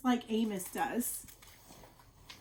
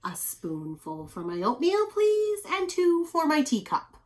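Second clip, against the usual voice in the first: sweet request; 7 semitones higher, 4 dB louder than usual. Acted. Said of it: "saying to the sugar bowl".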